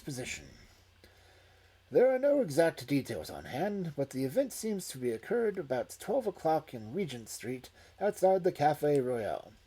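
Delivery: loud, formal newspaper reading; news report read aloud. A narrator's reading.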